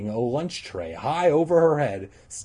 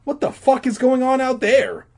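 much higher, loud alarmed cry; cried out; 7 dB louder than usual. Acted. Todd crying out at the playground, loud alarm. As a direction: yell it in alarm at the window. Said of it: "he cried".

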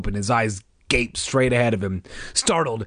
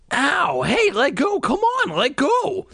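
high, squealing complaint; whiny protest; slower than usual. A narrator's reading. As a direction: struggle free, yelping. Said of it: "Bird protested".

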